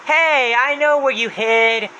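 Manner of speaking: high, loud, slow taunt; Happy's voice. Retold or imitated. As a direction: call it out triumphantly, drawing out the words. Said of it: "he says".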